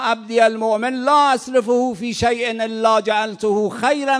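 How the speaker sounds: loud, slow pulpit declamation; preacher proclaiming to the congregation at full force; fiery preaching. Acted. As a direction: thunder the warning and the hadith.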